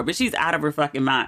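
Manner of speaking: ordinary talk, nothing marked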